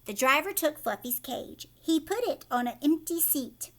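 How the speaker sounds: ordinary delivery, nothing marked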